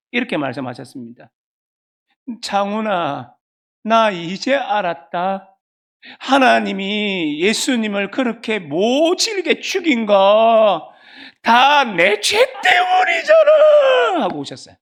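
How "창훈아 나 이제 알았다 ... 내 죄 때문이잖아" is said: slow, breaking into a crying shout at the end; mother's tearful confession. Retold or imitated. Mother confesses to her son, slow, ending in a crying shout. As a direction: build from slow trembling words to a sobbing cry. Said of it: "그대로 제가 해볼게요".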